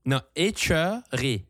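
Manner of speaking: écheurer said slowly, syllable by syllable, é-cheu-rer; slow careful demo. Shown as a demonstration. Teaching a French friend the Acadian word, slow and careful. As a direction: spell out écheurer slowly so she catches it. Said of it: "As-tu jamais entendu de écheurer".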